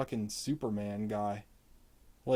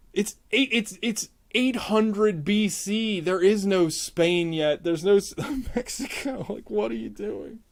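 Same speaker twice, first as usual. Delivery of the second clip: high-pitched, incredulous rant; exasperated disbelief; 7 semitones higher than usual. Real, not acted. Critic ranting at the filmmakers over anachronistic accents, exasperated disbelief.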